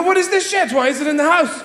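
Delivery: very high, exasperated squawk; mock bewildered shout; baffled outrage. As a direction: demand it in disbelief. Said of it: "you go".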